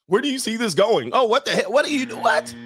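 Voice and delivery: high-pitched mock-woman questioning; hammed-up shrieky alarm; pestering girlfriend. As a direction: imitate a woman pressing him, voice way up. Acts woman pressing the man.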